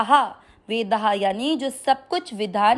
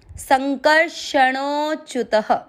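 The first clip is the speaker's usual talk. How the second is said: slow demo; 3 semitones higher, slower than usual. Shown as a demonstration. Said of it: "teaching the joined word".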